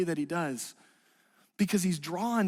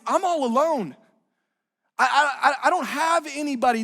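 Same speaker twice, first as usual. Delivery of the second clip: pleading outburst; 4 semitones higher, 5 dB louder than usual. Retold or imitated. Preacher voices the abandoned man's cry.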